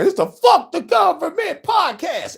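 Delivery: high, loud and slow title yell; shouted show title. Real, not acted. Host shouting out his podcast name to listeners. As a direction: call out the podcast name like an announcer.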